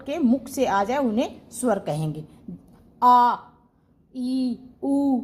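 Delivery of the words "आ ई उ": आ ई उ sounded out one by one, clear and unblocked; vowel demo. Shown as a demonstration. Teacher demonstrates vowels to class, open and clear. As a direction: say आ, ई, उ slowly and cleanly. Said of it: "कुछ भी रुकावट नहीं हो रही".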